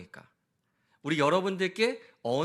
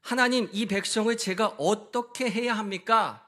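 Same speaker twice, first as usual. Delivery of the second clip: higher, louder plea on 하나님; crying out to God; 5 semitones higher, 6 dB louder than usual. Retold or imitated. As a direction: call out 하나님 like a desperate plea. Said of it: "하나님께 부르짖으면서".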